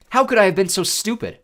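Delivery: self-reproach. A narrator's reading. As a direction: berate yourself in alarm. Narrator's urgent panicked thought.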